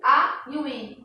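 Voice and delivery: usual speaking voice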